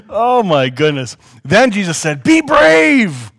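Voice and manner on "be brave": high, loud rallying call. Acted. Jesus calling to frightened disciples, loud reassuring call. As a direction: call it out boldly over the waves.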